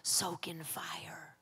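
slow, hushed whisper; God's whispered voice. Retold or imitated. God speaking through the noise at night, whispered. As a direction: breathe it out softly and slowly. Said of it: "God's voice was able to finally penetrate".

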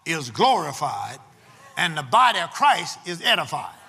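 loud, emphatic preacher with long-held key words; full-force preaching. Real, not acted. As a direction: preach it to the congregation in bursts, punching the key words.

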